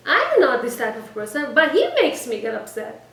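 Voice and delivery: loud mock protest of innocence; upset wife. Quoting what most people say about their spouse, mock upset. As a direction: raise the voice in imitated indignation. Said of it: "She's so upset at her husband".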